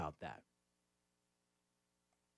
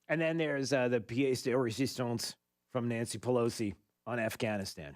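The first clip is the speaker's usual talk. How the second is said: ordinary talk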